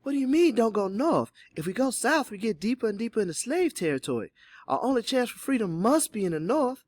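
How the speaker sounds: put-on high, agitated young woman's objection; high-pitched, incredulous protest; Polly interjecting. A narrator's reading. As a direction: argue back in a high, urgent voice. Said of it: "Polly interjected".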